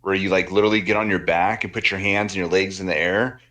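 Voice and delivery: ordinary talk, nothing marked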